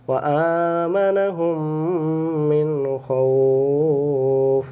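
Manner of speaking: very slow, melodic drawn-out recitation; Quran recitation. Shown as a demonstration. Teacher modelling the correct words for students, slow chant. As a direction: stretch মিন and জু'ইউ in a long chant. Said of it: "recites verse as model".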